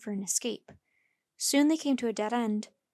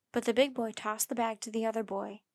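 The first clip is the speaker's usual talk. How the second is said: ordinary delivery, nothing marked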